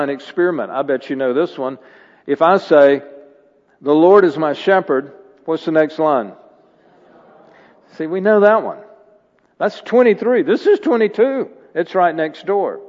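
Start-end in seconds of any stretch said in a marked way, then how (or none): 2.3-12.8 higher, louder preaching, building; full preaching. Acted. Pastor explaining rabbis to the church, full preaching. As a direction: lean into it like a revival preacher.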